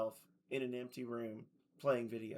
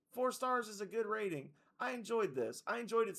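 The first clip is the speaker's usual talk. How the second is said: high joking voice; 9 semitones higher than usual. Acted. Joking about the game's age.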